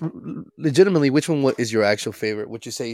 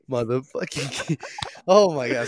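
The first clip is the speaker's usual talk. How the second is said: laughing through words; 3 semitones higher, slower than usual. Real, not acted. Recovering from the scare.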